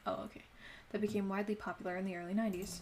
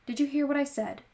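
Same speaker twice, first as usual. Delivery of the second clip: higher, louder stern mother; stern scolding; 6 semitones higher, 5 dB louder than usual. Acted. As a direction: snap at him like a strict mom. Mother scolding son about going out.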